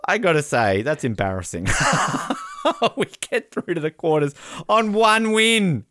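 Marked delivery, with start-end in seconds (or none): 1.7-5.9 high, laughing disbelief. Real, not acted. Laughs at undeserved qualification.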